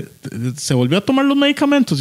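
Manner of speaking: lightly voiced Flash poking fun; mock casual question; Flash asking. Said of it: "le dice".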